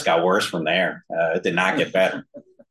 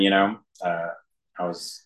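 ordinary talk

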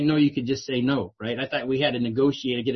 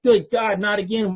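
higher, louder mock exasperation on Good God; hammed-up fed-up sigh of not again; exasperated groan; 6 semitones higher, 5 dB louder than usual. Hearing the same old share again, exasperated. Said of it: "And you're just like".

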